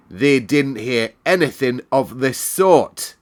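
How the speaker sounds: loud, slow, stern Yorkshire voice; cross housekeeper. A narrator's reading. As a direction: shut the child down coldly. Housekeeper scolding Mary.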